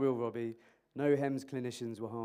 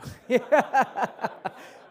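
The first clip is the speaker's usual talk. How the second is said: loud real laugh, pitched up; burst of laughter; 9 semitones higher, 12 dB louder than usual. Real, not acted. Lecturer laughing at his own condescending question, a loud laugh.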